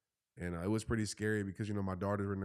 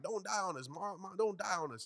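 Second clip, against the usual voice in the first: high imitated daughter, a bit softer; unsad child's plea; 9 semitones higher, 4 dB quieter than usual. Daughter to her mom in the car, imitated unsad plea. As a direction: mimic a kid's demand, no sadness. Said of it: "not in a sad way".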